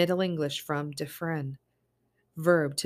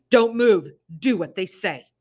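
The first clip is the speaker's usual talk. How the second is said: loud, higher tearful plea; sobbing plea; 4 semitones higher, 11 dB louder than usual. Acted. Mother sobbing to her kids to obey. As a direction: plead through tears. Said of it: "Summer sobbed".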